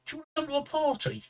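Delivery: put-on a self-confessed poor Welsh accent, quick and light; attempted Welsh accent. Teller imitates a Welsh woman's offer, weak Welsh accent. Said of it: "I can't do a Welsh accent".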